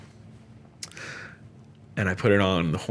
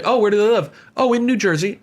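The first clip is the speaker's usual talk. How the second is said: mock dialogue; 11 semitones higher, 4 dB louder than usual. Mock exchange about New Jersey.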